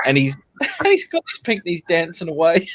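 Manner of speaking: high-pitched, laughing through the words. Real, not acted. Telling friends about a man dancing in a tutu, laughing. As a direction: crack up while telling it.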